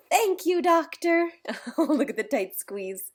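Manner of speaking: ordinary delivery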